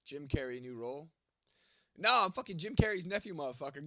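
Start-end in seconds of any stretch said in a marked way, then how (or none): 2.0-3.9 loud, higher-pitched indignant retort; punchy, raised-voice correction. Real, not acted. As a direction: set them straight, loudly. Correcting a chatter's mistake.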